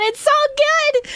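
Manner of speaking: high, slow, thrilled gush; excited gushing. Real, not acted. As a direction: stretch it out in delight. Gushing over character.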